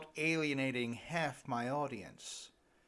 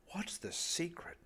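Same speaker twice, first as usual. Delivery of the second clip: whisper; 4 semitones higher, 8 dB quieter than usual. Retold or imitated. Asking old couples their secret.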